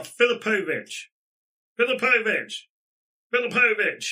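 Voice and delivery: loud, high yell of a name; repeated yelling. Acted. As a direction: yell the name out, falling at the end each time. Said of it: "She's just yelling the name repeatedly".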